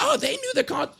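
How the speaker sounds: high, sneering mock voice; mocking quote. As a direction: toss it off in a mocking parody. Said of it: "And be like".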